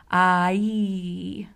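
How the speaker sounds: "I" stretched from ah into ee; gliding diphthong. Shown as a demonstration. Narrator demonstrating an English long vowel, slow gliding "I". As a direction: draw out "I" so the vowel visibly moves. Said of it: "That's a sound called a diphthong".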